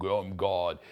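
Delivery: a hint of a low, booming divine voice; booming God voice. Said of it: "this booming voice".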